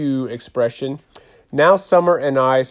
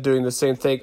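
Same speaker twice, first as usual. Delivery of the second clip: ordinary delivery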